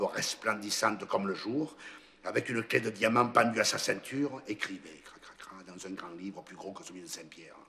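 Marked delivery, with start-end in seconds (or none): 5.0-5.6 pen scratching. A narrator's reading.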